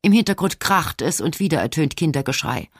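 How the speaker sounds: ordinary delivery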